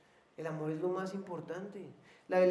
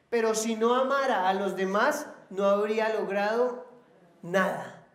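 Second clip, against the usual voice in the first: raised, building scripture proclamation, falling on each "nada"; forceful scripture preaching; 3 semitones higher, 7 dB louder than usual. Real, not acted. Preacher reading Corinthians on love to the church, forceful proclamation. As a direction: proclaim it loudly, then land heavily on "nada".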